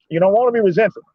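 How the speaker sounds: ordinary delivery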